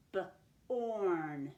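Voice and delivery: "B-orn" split into a short buh, then a long held orn; sounded out. Shown as a demonstration. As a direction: sound out "B-orn" with a gap after the b. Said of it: "Let's sound that out".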